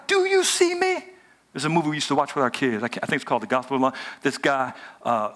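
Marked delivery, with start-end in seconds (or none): 0.1-1.0 high desperate cry to God. Retold or imitated. Abraham crying out to God in a high cry. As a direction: cry out, pleading to be seen. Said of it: "He's actually crying out to God".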